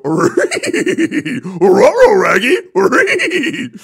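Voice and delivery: loud, high cartoon dog voice with r-sounds swapped in; Scooby-Doo voice. Friends begging for his Scooby-Doo impression, he does it loudly. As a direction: bark it out slow like Scooby-Doo. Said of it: "a good Scooby-Doo accent".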